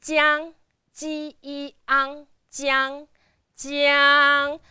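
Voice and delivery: ji, yi, ang, jiang, all flat and sustained; first tone. Shown as a demonstration. Teacher sounding out jiang, steady first tone. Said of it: "Jiang is the first one".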